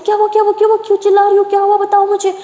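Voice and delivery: high, rapid worried questions; anxious, high-pitched shouted questions; alarmed calling out. A narrator's reading. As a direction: rush in asking what happened. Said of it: "Lakshmi चिल्ला के आती है".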